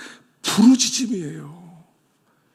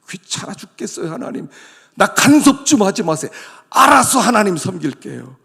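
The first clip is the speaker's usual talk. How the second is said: louder, half-crying complaint; whiny brush-off; 4 semitones higher, 8 dB louder than usual. Acted. 간섭이 싫은 성도가 하나님께, 크고 칭얼대는 투정. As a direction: beg God to stop meddling. Annoyed believer to God.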